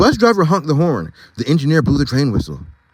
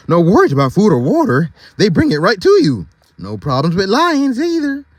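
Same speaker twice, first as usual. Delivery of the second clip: character voice; 7 semitones higher, 3 dB louder than usual. Acted. Reread in character voice.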